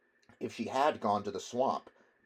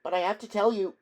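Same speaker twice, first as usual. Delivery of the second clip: anxious plea; 11 semitones higher, 5 dB louder, faster than usual. A narrator's reading. Boy pleading with mother.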